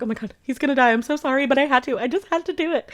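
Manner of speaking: high, rushed, imitation tearful pleading; mock tearful. She tells the man bleeding out she's sorry, mock tearful. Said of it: "she starts crying".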